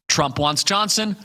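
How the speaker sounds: usual speaking voice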